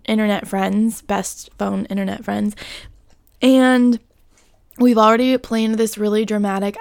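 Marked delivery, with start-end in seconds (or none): none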